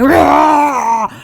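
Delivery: loud, long mock roar; imitated lifter's yell, full blast; weight-room roar. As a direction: let out a huge high growling yell. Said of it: "and then he's like".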